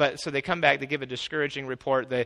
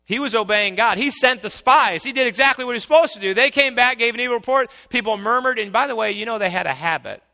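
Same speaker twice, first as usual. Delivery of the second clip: high, loud rapid defence; fervent preaching; 7 semitones higher, 9 dB louder than usual. Acted. Preacher defending Moses, high, loud and fast. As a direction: rattle it off with fire.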